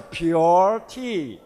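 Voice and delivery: "pure" drawn out with a clear pyoo sound; recommended pronunciation. Shown as a demonstration. Presenter showing how the name should sound, "pure" stretched as pyoo-er. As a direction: say "pure" slowly and firmly, as in purity. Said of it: "So it'll sound like a".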